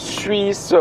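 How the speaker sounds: ordinary talk, nothing marked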